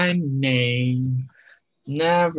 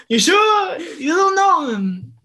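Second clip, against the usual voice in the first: gushing delighted tourist; 13 semitones higher, 5 dB louder than usual. Pretend. Imagining himself in an Italian town, high gushing tourist. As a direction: gush over everything like a wide-eyed tourist. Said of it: "pretend tourist insists".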